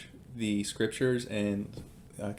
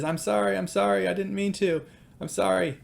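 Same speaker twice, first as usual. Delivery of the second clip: put-on much higher child's voice, apologetic; imitated pleading apology; 8 semitones higher than usual. Hurt daughter to dad after disobeying, imitated pleading apology. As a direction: plead like a sorry little kid.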